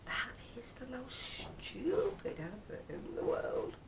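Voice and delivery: slow, high, drawn-out exasperation; exasperated disdain. Real, not acted. Ranting to viewers about an advert, exasperated disdain. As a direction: drag it out in sheer disbelief.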